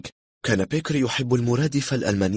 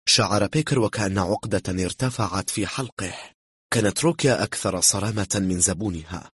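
usual speaking voice

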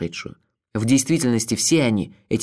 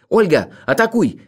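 raised, louder urgent cry; shouted command; 6 semitones higher, 4 dB louder than usual. A narrator's reading. Narrator shouts to Olga to attack, urgent cry. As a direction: call out the order sharply. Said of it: "крикнул я".

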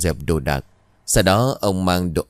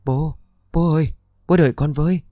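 high, distant pleading to his dead father; a sleeper begging his father to wait, high and far-off; dream mumble; 8 semitones higher than usual. Acted. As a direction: call after your father in a dream, lightly voiced. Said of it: "miệng không ngừng lẩm bẩm".

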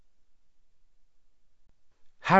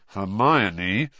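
through gritted teeth. A narrator's reading. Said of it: "Harry said through gritted teeth".

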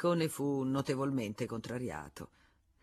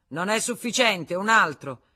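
loud, raised rejection; curt dismissal; 4 semitones higher, 9 dB louder than usual. Acted. Senecal rejecting Dussardier as guarantor, loud and curt. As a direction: brush the offer aside loudly.

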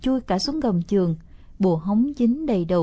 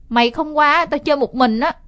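loud, higher pretend huff; mock sulk; 6 semitones higher, 5 dB louder than usual. Boy pretends to sulk so his sister comes over, loud mock huff. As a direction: fake a sulk to bait her. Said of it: "giả vờ giận dỗi nói lớn".

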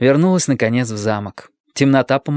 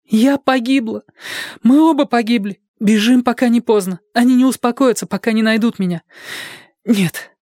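put-on high, breathless girl's plea; pleading frightened girl; 11 semitones higher than usual. A narrator's reading. Joanna begging Dick to let her face them, high and pleading. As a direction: beg him to let her go alone.

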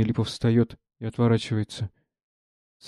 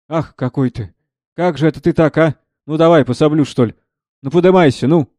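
higher, louder worried urging; 4 semitones higher, 7 dB louder than usual. Acted. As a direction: fret over the lame man, urge him up. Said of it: "озабоченно". (озабоченно, подгоняя хромого)

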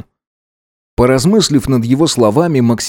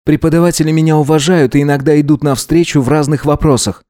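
slightly raised, lightly voiced self-defence; prim good-student figure; 5 semitones higher, faster than usual. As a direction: defend your good name. Voices Gideon. (сдержанно, с достоинством отличника)

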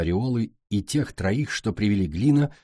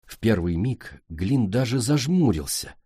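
usual speaking voice

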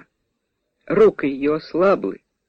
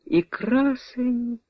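added aloud; 12 semitones higher, 6 dB quieter, much slower than usual. A narrator's reading. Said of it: "Віра додала вголос".

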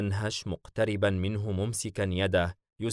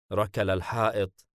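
ordinary delivery

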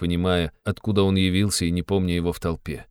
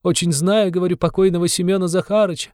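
Katerina Ivanovna crying out; 11 semitones higher than usual. A narrator's reading. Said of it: "закричала она чиновнику". (высокий отчаянный крик вдовы)

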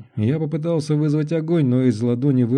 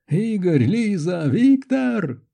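names shouted higher and higher; yelling; 8 semitones higher, slower than usual. A narrator's reading. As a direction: yell to friends who can't hear you. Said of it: "заорал я". (орать, всё выше с каждым именем)

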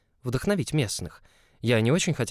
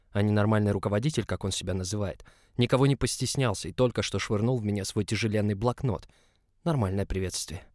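usual speaking voice

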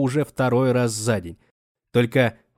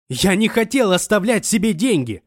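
raised, high desperate yell; shouting; 9 semitones higher, 4 dB louder than usual. Acted. Wounded man shouting his denial at the studio audience. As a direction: shout the denial at the whole hall. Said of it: "снова кричал он".

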